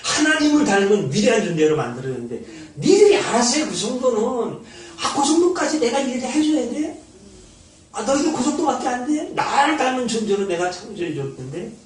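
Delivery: a lightly voiced God chiding his people; exasperated God. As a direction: play God asking, half amused, "is that all you can do?". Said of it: "이러시지 않겠어요? 하나님께서". (답답해하며 나무라는 하나님 흉내)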